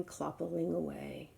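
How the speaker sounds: ordinary delivery, nothing marked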